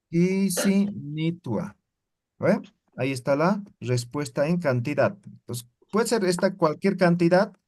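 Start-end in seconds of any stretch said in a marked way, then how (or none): 0.1-1.8 pisinitua drawn out very slowly; slow dictation. Shown as a demonstration. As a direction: dictate pisinitua as you write it. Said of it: "aquí voy a escribir".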